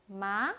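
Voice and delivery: 마 lightly rising; 마 with a light rise. Shown as a demonstration. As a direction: let 마 glide gently upward. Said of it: "가볍게 올라갔죠?".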